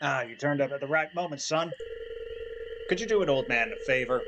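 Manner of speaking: a hint of a folksy old man asking a favor; mayor asking the player to scare off raccoons, lightly accented. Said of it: "I need to stop doing the accents".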